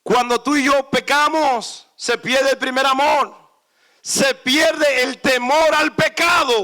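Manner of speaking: loud, high sermon cadence; fiery preacher, slow and emphatic at the end; full-force preaching. Real, not acted. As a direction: preach it hard, slowing on each pierde.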